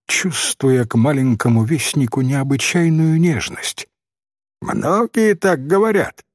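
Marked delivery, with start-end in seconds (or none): 4.6-6.2 put-on high-pitched snide boy; mildly caustic child's remark; sarcastic. A narrator's reading. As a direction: needle her with doubt. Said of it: "язвительно заметил мальчик".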